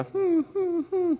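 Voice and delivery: short, high repeated hoots; high-pitched animal call. Retold or imitated. Speaker showing how they call you closer, high-pitched hum. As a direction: imitate an animal's high come-closer whimper. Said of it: "They'll raise their pitch into a".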